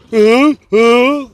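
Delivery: a taunting, dismissive huh huh; lightly voiced Trump mockery from dad; mocking Donald Trump. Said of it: "making fun of me doing the, you know, the Donald Trump thing".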